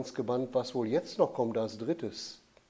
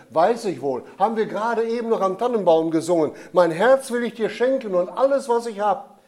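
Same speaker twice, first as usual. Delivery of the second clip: put-on louder, higher, eager child's voice; eager boy; 6 semitones higher, 6 dB louder, faster than usual. A narrator's reading. Michael eagerly offering his heart to the Jesus child, loud and high. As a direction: rush it out like a keen little boy.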